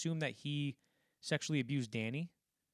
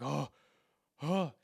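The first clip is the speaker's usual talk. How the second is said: imitated gasps of shock; horrified gasp; 3 semitones higher, 5 dB louder than usual. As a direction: mimic a man recoiling in horror. Retold man's horror at rotting woman.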